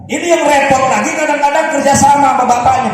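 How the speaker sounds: loud, fast, high preaching burst. Acted. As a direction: drive it home with force. Preacher animating a hard parenting scene.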